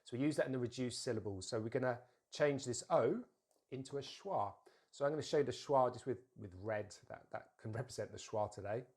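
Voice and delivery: usual speaking voice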